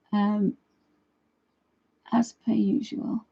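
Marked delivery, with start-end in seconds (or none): 2.1-3.2 slow conspiratorial whisper; whispered aside. Acted. Whispering the dog joke aside to viewers.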